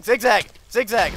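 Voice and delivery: high, loud barked call; frantic yelled instruction, said twice; urgent shouting. Real, not acted. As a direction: shout it urgently while fleeing. Calls out while fleeing.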